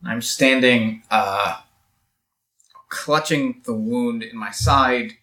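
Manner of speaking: usual speaking voice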